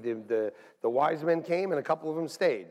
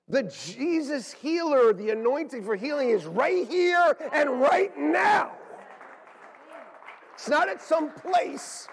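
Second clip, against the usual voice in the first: preaching shout; 9 semitones higher, slower than usual. Real, not acted. Preacher proclaiming healing is here.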